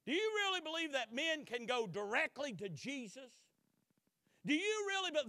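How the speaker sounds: loud, high incredulous grilling; interrogating. Retold or imitated. Court interrogating Luther, loud and incredulous. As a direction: press him hard with each question. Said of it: "this is the line of questioning".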